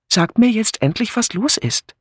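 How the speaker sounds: high-pitched complaining plea; exasperated, wailing lilt; plaintive. A narrator's reading. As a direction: beg plaintively to be told. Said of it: "rief Philippa klagend".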